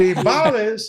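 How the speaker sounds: high, loud, laughing disbelief at the word "Timbales?"; mock-shocked "Timbales?" with a laugh; retelling the bandleader's amused surprise at "Timbales?"; incredulous. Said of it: "And he goes".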